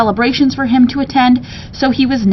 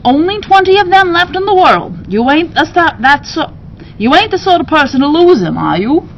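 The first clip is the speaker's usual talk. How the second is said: bird keeper voice; 7 semitones higher than usual. Acted. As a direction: press him with a folksy high voice.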